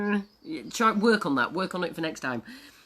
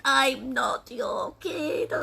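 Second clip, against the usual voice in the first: slow, hammed-up sobbing; tearful little egg; 4 semitones higher, much slower than usual. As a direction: wail it out in mock tears. Egg sobs at being nobody's child.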